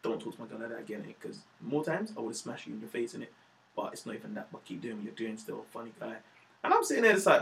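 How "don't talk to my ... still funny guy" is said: imitating the boyfriend's threat. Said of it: "what I'm saying is".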